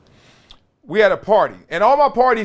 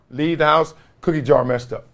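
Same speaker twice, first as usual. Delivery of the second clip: normal talking voice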